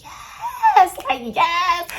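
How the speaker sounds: high, loud drawn-out whoop; thrilled cheer. Retold or imitated. Dreamer having a ball on the roller coaster, thrilled cheer. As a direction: cheer wildly, pitch leaping up.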